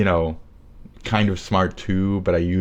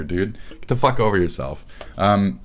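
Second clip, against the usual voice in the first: ordinary talk, nothing marked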